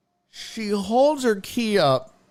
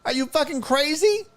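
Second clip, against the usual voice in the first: indignant rant; 7 semitones higher than usual. Retold or imitated. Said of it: "I was like".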